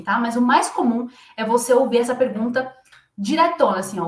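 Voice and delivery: normal talking voice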